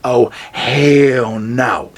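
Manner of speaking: slow, drawn-out refusal; hell no. Retold or imitated. Vlogger refusing the roadside hitchhiker, dragging it out. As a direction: stretch it out in flat refusal. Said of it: "I'd be like".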